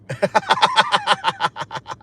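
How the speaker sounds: rapid staccato laugh. Real, not acted. Streamer cracking up after saying no, laughing. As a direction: burst into a run of quick laughs.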